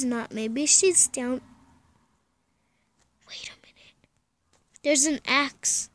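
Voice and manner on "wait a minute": faint breathy whisper; barely audible whisper. Real, not acted. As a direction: hush it, noticing something.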